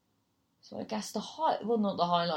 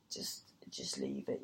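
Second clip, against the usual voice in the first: whispered low advice, barely voiced; hushed whisper; 9 dB quieter than usual. Fellow comics warning her off, in a whisper. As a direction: hush it like backstage advice. Other acts advising her quietly.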